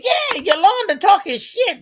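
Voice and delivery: high, loud gleeful catching-on; retold excitement. Host retelling finally getting the nickname, excited. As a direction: burst out high, acting out the realization.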